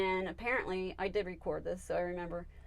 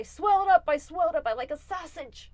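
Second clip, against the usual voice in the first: high, loud exclaiming pet; channeled pet; 9 semitones higher, 9 dB louder than usual. Acted. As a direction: blurt it out way up high. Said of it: "And he said".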